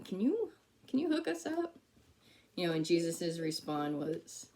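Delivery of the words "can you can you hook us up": high, sweet begging mother voice; a mother coaxing her son for a favour; mock mom voice. Preacher voicing Mary asking Jesus for wine, high and sweet.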